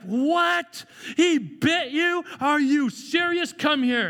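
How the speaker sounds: high, slow mock disbelief; hammed-up parental shock. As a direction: react like a stunned parent. Parent reacts to biting.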